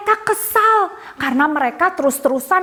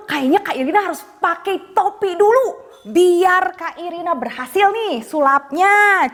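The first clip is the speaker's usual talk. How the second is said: put-on bright, high children's show voice; kids party magician; 6 semitones higher than usual.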